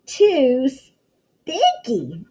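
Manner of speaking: drawn-out, slow whiny complaint; complaining cry. Acted. Goldilocks tasting porridge, a slow fussy cry. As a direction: stretch it out like a fussy child. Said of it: "she cried".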